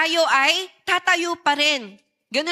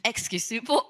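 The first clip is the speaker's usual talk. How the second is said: usual speaking voice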